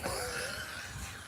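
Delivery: soft breathy laugh; real laugh. Real, not acted. Storyteller amused at having run away, soft laugh. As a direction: laugh quietly at your own story.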